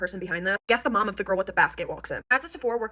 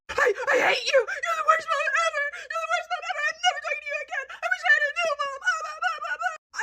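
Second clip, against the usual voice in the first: very high mock sobbing tantrum; hysterical crying imitation. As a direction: wail like a child melting down at mom. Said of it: "hysterically crying".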